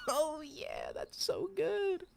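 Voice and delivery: very high-pitched gushing; high, happy squeal; delighted squeal. Real, not acted. Still delighted by the dance.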